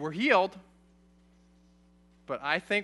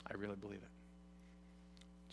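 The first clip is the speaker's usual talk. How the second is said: whispered conviction; 10 semitones lower, 23 dB quieter, slower than usual. Real, not acted. Pastor's quiet personal conviction.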